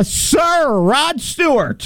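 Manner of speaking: high, slow, mock-grand announcer; grand announcement. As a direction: draw out Sir like a herald. Announcing Rod with fanfare.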